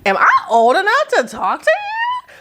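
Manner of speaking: high squeaky self-doubt, way up in pitch; squeaky "am I even allowed" voice; mock girlish disbelief. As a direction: pipe it up like a nervous young girl. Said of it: "And I'm like".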